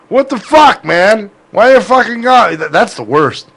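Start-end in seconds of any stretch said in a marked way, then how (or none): 0.1-2.5 put-on loud, high-pitched drunken whine; drunk caller protesting. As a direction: imitate a drunk brother wailing in protest. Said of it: "he would call just drunk".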